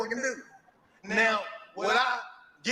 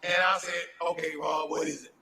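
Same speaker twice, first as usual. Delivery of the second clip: quiet voice; 7 semitones lower, 6 dB quieter than usual. Retold or imitated. Retold late-night phone call.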